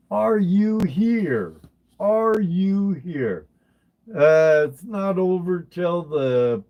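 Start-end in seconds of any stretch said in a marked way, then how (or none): 0.1-3.5 slow, high call to the absent winner; drawn-out higher call. Real, not acted. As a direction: stretch it out, looking for them. Calling for the winner.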